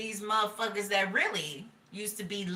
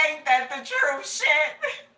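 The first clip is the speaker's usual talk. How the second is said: imitating Carlin; 8 semitones higher, 5 dB louder, slower than usual. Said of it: "He like".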